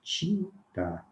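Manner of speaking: tinta drawn out with tchi; slow tinta. Shown as a demonstration. Teacher modelling tinta with tchi. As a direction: say tinta slowly as tchinta. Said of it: "representação fonética dele seria assim".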